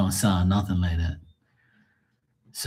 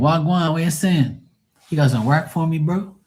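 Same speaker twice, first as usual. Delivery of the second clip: higher-pitched Bermudian greeting; Bermudian street talk; 7 semitones higher than usual. Acted. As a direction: hail a client like a Bermudian friend. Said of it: "talk like I talk to my gangster friends".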